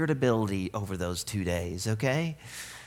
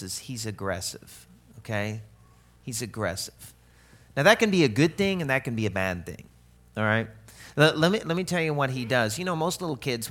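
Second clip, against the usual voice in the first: ordinary talk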